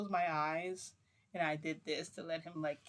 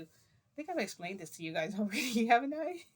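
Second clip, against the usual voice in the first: laughing; 5 semitones higher, 7 dB louder than usual. Real, not acted. Laughing at own rambling.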